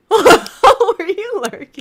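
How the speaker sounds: high, slow laughing echo. Real, not acted. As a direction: tease through laughter. Echoing the question at viewer.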